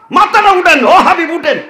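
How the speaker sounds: high, urgent acted summons; impassioned calling. Acts God calling the Prophet up.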